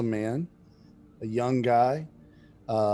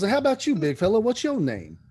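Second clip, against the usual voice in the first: much higher, louder, jovial call to the big fella; hearty abbot; 10 semitones higher, 5 dB louder than usual. Acted. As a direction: boom a cheerful greeting at the big guy. Abbot greets the big fighter.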